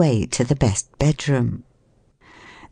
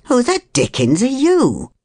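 higher, swooping demand; indignant man; 9 semitones higher than usual. A narrator's reading. Man finding a stranger in his bed, indignant. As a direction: challenge the intruder with rising disbelief.

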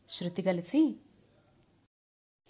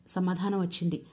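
ordinary delivery

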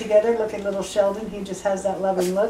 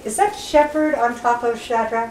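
ordinary talk

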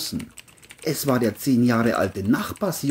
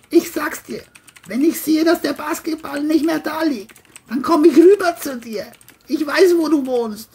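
John threatening. Acted. John threatens the boy over the basketball, high angry voice.

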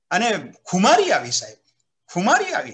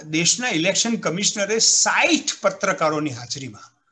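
usual speaking voice

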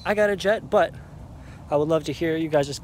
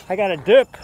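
loud; 4 semitones higher, 10 dB louder than usual. Real, not acted. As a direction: call it out loud. Announcing he must leave, louder.

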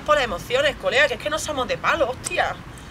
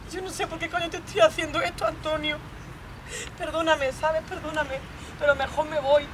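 higher, soft apology; pleading apology; 4 semitones higher, 6 dB quieter than usual. Acted. Girlfriend asking Antonio to forgive her, pleading softly. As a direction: apologise small and high-pitched, backing away.

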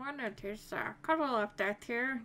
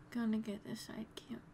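whispered sneaking; whispering; 7 dB quieter than usual. Real, not acted.

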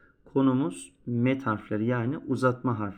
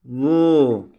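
loud, spaced syllable drill; reciting syllables; 4 semitones higher, 8 dB louder, much slower than usual. Shown as a demonstration. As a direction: call out each sound for the class to copy. Teacher drills letter sounds.

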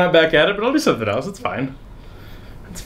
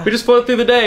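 carefree voice; 11 semitones higher than usual. Retold or imitated. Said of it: "so you're like".

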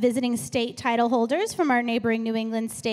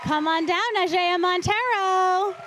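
announcer call-out; 6 semitones higher, 4 dB louder than usual. Real, not acted. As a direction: call the name to the hall. Host calling contestant to stage.

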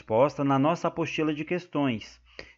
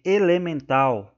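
ELEMENTAL lento e forte, com acento no TAL; tônica na última; 4 semitones higher, 6 dB louder, slower than usual. Shown as a demonstration. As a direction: alongar ELEMENTAL, marcando a sílaba final TAL. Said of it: "a sílaba tônica é a última". (ELEMENTAL pausado, tônica em TAL)

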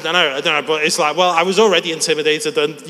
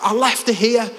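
high, insistent Scouse; Scouse accent; 8 semitones higher, 3 dB louder than usual. Retold or imitated. Lad who lost his phone, high insistent Scouse. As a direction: accuse in broad Scouse. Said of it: "give you a glimpse of the scouse".

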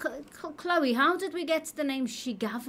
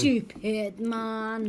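very slow drawn-out put-down; mock scolding; much slower than usual. Echoing the friend's insult at her cohost, slow mock scorn. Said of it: "Having a go at me".

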